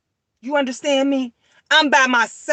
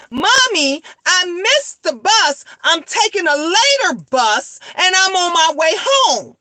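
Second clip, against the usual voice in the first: heated scolding; 5 semitones higher, 4 dB louder than usual. Retold or imitated. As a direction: chew her out, higher and louder, dragging the words. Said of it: "'cause now I'm upset, I'm kinda heated".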